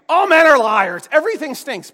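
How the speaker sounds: loud, high outburst of complaint; crying out. Acted. Preacher voices the psalmist's honest complaint, loud outcry. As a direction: burst out with the raw grievance. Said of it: "cry out to God with what really is happening".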